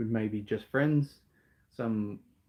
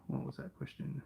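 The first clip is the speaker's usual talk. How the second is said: soft whispered question off to the side; whispered aside; 4 semitones higher, 11 dB quieter than usual. Real, not acted. Asking the interviewer off-mic, whispered. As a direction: drop to a whisper to check.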